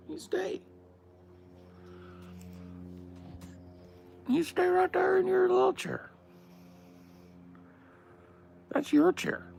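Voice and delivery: very high pet-talk voice; talking to the dog. Acted. Settling the dog onto its chair, very high doting pet voice. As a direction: coo at the dog way up high, sing-song.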